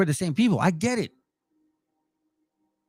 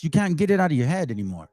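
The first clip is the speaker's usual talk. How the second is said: usual speaking voice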